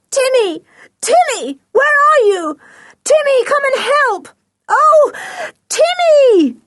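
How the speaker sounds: very high, desperate screamed calls; loud scream. A narrator's reading. George grabbed roughly by the men, screaming for Timmy. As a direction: scream for the dog to come and save you. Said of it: "She screamed loudly".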